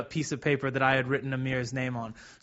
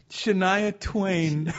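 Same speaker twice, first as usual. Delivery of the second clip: laughing; 5 semitones higher, much slower than usual. Real, not acted. Laughing, drawn out.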